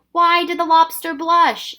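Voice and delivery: loud, high, slow yell. Acted. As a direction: shout the riddle out. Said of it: "yelled Ben".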